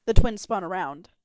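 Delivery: plain reading voice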